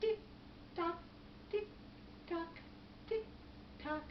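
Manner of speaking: soft, evenly spaced tick-tocks; hushed, measured ticking; clock ticking. Acted. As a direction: imitate a quiet clock, leaving gaps. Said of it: "there was the ticking of the clock".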